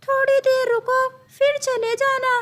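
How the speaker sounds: high, bright-pitched teacher bird voice; a high squawky parrot teacher calling on a student. Acted.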